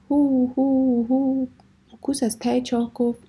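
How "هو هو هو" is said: high, loud calling cry; shouting. A narrator's reading. Jules calls his hunting dogs, high loud shout. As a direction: shout out to the hounds. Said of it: "سپس فریاد کشید".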